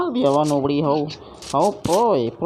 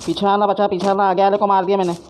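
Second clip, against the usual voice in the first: higher, louder, fast rush of words; excited shout; 6 semitones higher, 5 dB louder, faster than usual. Real, not acted. Gamer rushing a kill report to his teammate, fast and loud. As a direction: blurt it out in the heat of the fight.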